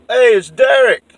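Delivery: high, loud, slow call from far off; calling back. Retold or imitated. Derek answering from below, loud slow call.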